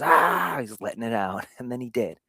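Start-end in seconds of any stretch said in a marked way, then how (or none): 0.0-0.6 mock child yell. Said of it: "and he goes".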